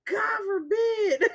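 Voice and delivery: high, slow cry of laughter, real; laughing shout. Real, not acted. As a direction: wail it out laughing. Laughing at porn idea.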